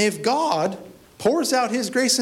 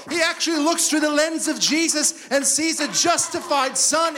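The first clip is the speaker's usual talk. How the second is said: preaching shout; 8 semitones higher than usual. Real, not acted. Preaching climax to congregation.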